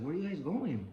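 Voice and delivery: high, lightly voiced old lady calling out; old lady asking. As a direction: call from the doorway like an old neighbor. Old lady at her door.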